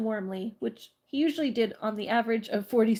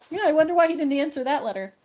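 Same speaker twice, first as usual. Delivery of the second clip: sarcastic; 5 semitones higher, 5 dB louder, faster than usual. Real, not acted. Sarcastic comment on the letter.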